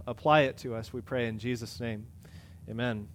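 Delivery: soft, hushed prayer voice. Acted. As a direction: pray quietly and reverently. Pastor leads an opening prayer.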